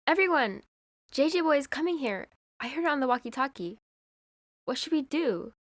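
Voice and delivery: alarmed call. Acted. Pamela warns the team the villain is coming, urgent. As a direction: call everyone in a hurry.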